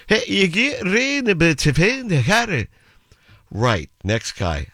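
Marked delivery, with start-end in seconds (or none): 0.1-2.7 high, garbled Scots local; comic Scottish gibberish, pitched way up; unintelligible Scottish accent. Acted. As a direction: gabble directions in impenetrable put-on Scots. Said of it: "as the best Scottish story".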